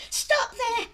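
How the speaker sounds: loud, high cry to halt him; sharp cry. Acted. Maiden crying out to stop a suitor's approach, loud and high.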